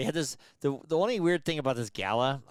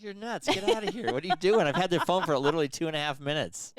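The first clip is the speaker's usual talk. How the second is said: incredulous yelling; 5 semitones higher than usual. Retold or imitated. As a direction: scold her in disbelief, laughing. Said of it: "I'm like".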